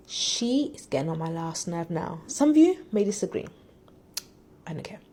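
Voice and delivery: ordinary talk, nothing marked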